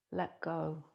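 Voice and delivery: ordinary talk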